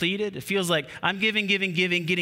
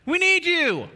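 needy pleading; 5 semitones higher, slower than usual. Acted. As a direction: whine need need need at him. Disciples pleading with Jesus.

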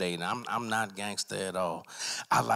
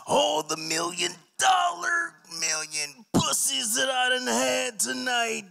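much higher, louder, slow wrestler growl-shout; Macho Man Randy Savage; 3 dB louder, slower than usual. Retold or imitated. [name] imitating a wrestler during sex, over-the-top promo yell. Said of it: "imitating Macho Man Randy Savage".

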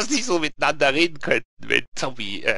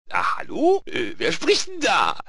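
high-pitched, hesitant questioning; baffled character, pitched way up; Lee; 9 semitones higher, 3 dB louder, slower than usual. Acted. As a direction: ask into the void, puzzled. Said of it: "dass Lee sowas anwerten könnte wie".

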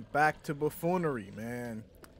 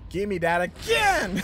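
loud, exultant yell rising high; triumphant shout; 3 semitones higher, 10 dB louder than usual. Real, not acted. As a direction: roar it out, thrilled with the win. Triumph at the end.

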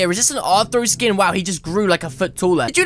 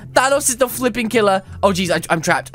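usual speaking voice

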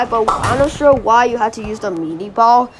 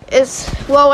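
normal talking voice

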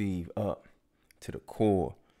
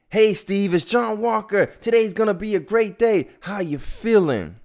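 bright, very high and loud cheer; cheerful wake-up call; 13 semitones higher, 10 dB louder than usual. Retold or imitated. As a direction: sing out the greeting like a morning hype man.

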